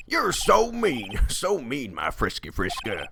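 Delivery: a light southern twang, hammed up. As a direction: drawl it like a smug southern judge. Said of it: "judge's voice with a southern twang".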